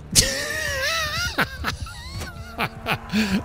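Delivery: high-pitched, uncontrollable laughing fit; hard real laughter. Real, not acted. Player cracking up at his favorite thing in Bannerlord, hard laughter. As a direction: crack up helplessly, high and wheezy. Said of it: "I'm laughing so hard".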